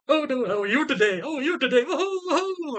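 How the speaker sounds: high, hammed-up jolly clown voice with laughs; mock clown. Mocking the doctor as a cheery clown. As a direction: play a giggling clown greeting a patient. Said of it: "dressed as a clown going".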